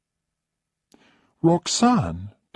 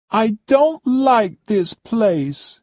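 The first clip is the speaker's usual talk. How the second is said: much higher and louder, a demanding whine; haughty high-pitched complaining lady; spoilt rich guest; 7 dB louder than usual. Acted. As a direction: play the pampered diva who expects service. Roxanne complains.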